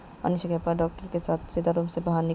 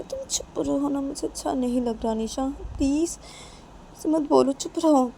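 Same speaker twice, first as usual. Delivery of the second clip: higher-pitched, upset appeal; pleading hushing; 7 semitones higher than usual. Acted. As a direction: beg them to stop talking. Manisha begs Nisha to stop.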